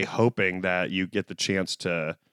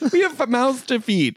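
high-pitched mock Canadian spouse; hammed-up Canadian nagging; weirdly Canadian wife; 12 semitones higher, 3 dB louder than usual. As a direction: plead with a hint of a Canadian accent. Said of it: "I don't know why she's weirdly Canadian there at the end".

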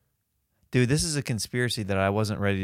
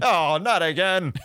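loud scolding toy dad; pull string toy; 6 semitones higher, 4 dB louder than usual. Acted. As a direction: scold like a talking doll. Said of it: "thirteen different sayings".

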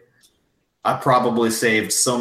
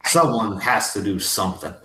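ordinary talk

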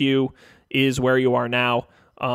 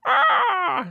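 high bird screech; falcon cry. Acted. Game master voicing an unseen falcon heard overhead, high screech.

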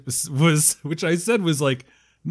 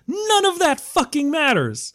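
exasperated outburst, pitched way up; 13 semitones higher than usual. Real, not acted. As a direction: blurt it out in frustration. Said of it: "you could tell by my outburst".

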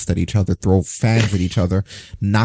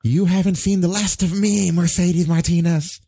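higher hammy villain vow, slow at the end; sputtering foiled villain; 7 semitones higher than usual. Pretend. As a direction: swear revenge like a cartoon baddie. Mock villain threat after tweet.

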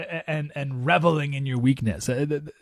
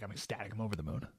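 normal talking voice

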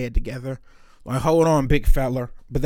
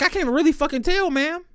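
high sarcasm; 11 semitones higher than usual. Retold or imitated. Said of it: "I was like".

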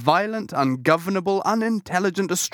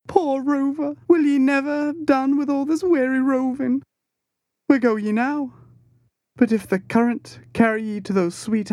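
put-on high, tearful boy's lament, much higher; Pip's keening high voice; 8 semitones higher than usual. A narrator's reading. Pip mourns Queequeg in his coffin, high and tearful. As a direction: grieve over him in a high, wavering voice. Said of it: "with soft sobbings".

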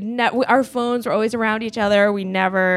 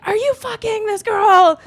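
high, loud, slow accusing yell; furious accusation; 13 semitones higher, 7 dB louder, slower than usual. Retold or imitated. Girlfriend confronting cheating boyfriend, shouting the accusation. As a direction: hurl the question at him in fury.

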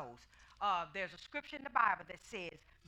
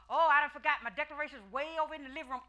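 mock excuse; 6 semitones higher, 7 dB louder, faster than usual.

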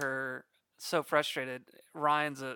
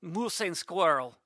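slow cartoon Russian "Moose and squirrel"; Boris Russian accent; 3 semitones higher, 3 dB louder, slower than usual. Shown as a demonstration. Doing basic Russian from Rocky and Bullwinkle, slow cartoon Russian accent. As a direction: growl it like the cartoon Russian villain. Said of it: "Rocky and Bullwinkle".

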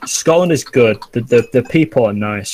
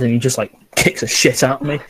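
normal talking voice

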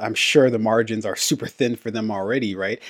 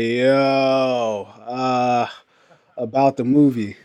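casual yo call; much slower than usual. Retold or imitated. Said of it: "call was like to these theaters".

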